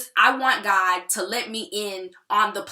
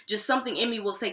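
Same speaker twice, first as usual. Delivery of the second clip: ordinary talk, nothing marked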